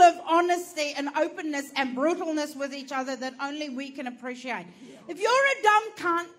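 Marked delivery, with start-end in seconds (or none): 5.1-6.3 shout. Real, not acted. Ranting at crowd.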